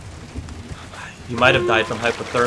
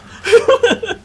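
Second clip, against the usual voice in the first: loud laugh; 7 dB louder than usual. Real, not acted. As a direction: burst of high laughter. Laugh after joke.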